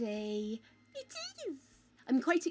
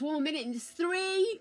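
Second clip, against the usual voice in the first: normal talking voice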